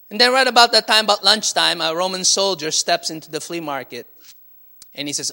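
ordinary talk, nothing marked

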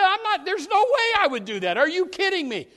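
loud, high, incredulous scoff; scoffing denial. Acted. Saul scoffing at his accusers, loud incredulous denial. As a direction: laugh the idea off in disbelief.

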